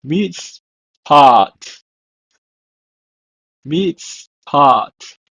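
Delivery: mitzpat said "meets-paht" twice; "Mitzpat" said twice, falling; Hebrew word. Shown as a demonstration. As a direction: model "mitzpat" then echo it. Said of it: "It's pronounced in Hebrew as".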